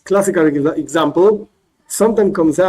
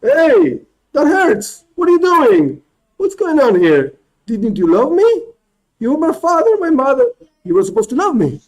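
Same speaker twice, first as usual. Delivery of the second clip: put-on hurt child protesting; 10 semitones higher than usual. Child hurt by parent's slap, high and wounded. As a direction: play the slapped child, hurt and accusing. Baby protests after hand slapped.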